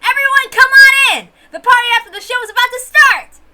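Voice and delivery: high, loud, gleeful shout; bubbling over, calling everyone inside; excited call. Acted. Girl calling the whole crowd into the party, high excited shout. Cheerful character calls everyone in.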